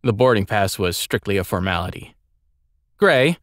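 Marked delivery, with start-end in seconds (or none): 3.0-3.4 sharp, higher call of a name; commanding call. Acted. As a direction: summon the servant curtly. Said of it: "The girl called the boy by name".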